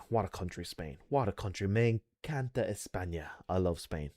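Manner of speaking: soft, low nostalgic sighing; wistful sigh. Real, not acted. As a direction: sigh fondly about Spain. Wistful about Spain.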